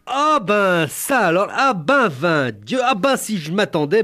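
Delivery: high, loud rustic woman; bellowing peasant woman. Retold or imitated. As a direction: bellow it out like a country wife. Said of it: "beuglait Supermolle".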